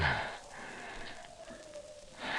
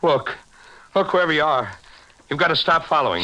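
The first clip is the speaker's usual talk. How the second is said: desperate plea; 8 semitones higher, faster than usual. Acted. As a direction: beg him to back off, rattled. Hunted man pleads, cornered.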